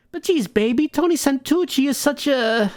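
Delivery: high, sweet-talking dame; gangster's moll voice. Acted. Moll sweet-talking her gangster in a high coo.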